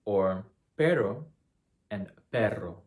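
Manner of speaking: pero with a single tap, perro with a long trill; tap versus trill. Shown as a demonstration. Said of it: "contrast from the single R".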